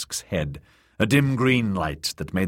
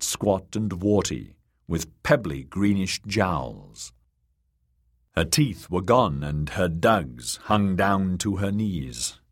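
ordinary delivery, nothing marked

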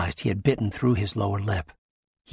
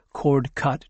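ordinary delivery, nothing marked

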